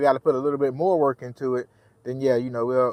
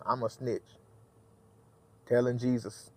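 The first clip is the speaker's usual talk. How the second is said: hushed aside; 3 semitones lower, 9 dB quieter than usual. Pretend. Joking aside after scolding driver.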